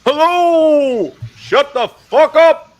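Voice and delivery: very high, loud drawn-out yell; native New York echo. Acted. Showing the others the native New York echo, high drawn-out holler. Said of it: "New York echo".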